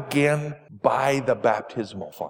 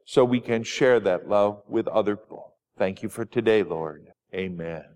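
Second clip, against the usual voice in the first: prayer; 4 semitones lower than usual. Acted. Pastor leads children in prayer.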